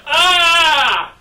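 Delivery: high mock scream of pain; long "Ah!" held high, faking a beating; pretend holler. Boy faking a spanking for his listening mother, mock holler. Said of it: "you holler".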